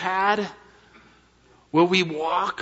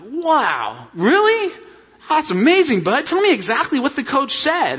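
much higher, louder wide-eyed mom; amazed mom; 10 semitones higher, 3 dB louder than usual. Retold or imitated. Wife reacting to son's goalie news, high and amazed. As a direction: gush like a delighted mother.

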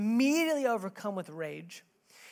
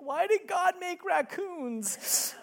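slow, higher mock small-child plea; crying four-year-old; 11 semitones higher, much slower than usual. Four-year-old crying in dad's lap about raccoons, mild mock whimper. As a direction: imitate the little girl's teary question lightly. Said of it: "crying in my lap".

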